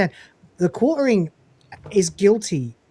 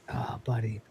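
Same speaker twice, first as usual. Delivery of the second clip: breathy awed whisper; 8 semitones lower, 12 dB quieter than usual. Real, not acted. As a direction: whisper it under your breath. Reacting in awe to clip.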